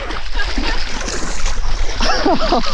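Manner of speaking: high breathless shock laugh. Real, not acted. Shock laugh in cold water.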